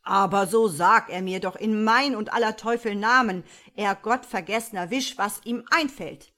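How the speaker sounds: lightly voiced devil, out of breath; devil panting. A narrator's reading. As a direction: gasp out the rebuke. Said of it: "fragte keuchend der Teufel".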